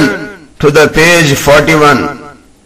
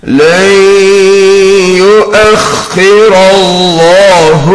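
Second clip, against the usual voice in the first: measured tajweed chant with long held nasals and vowels; Quran recitation; 3 semitones higher than usual. Shown as a demonstration. Teacher modelling Quran words for students, measured recitation. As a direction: recite each phrase steadily, drawing out the elongations.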